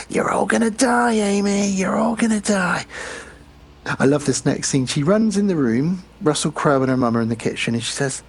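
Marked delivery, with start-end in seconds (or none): 0.1-2.8 higher, eerie phone ghost; ghostly dad. Retold or imitated. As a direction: wail it like a ghost on the line.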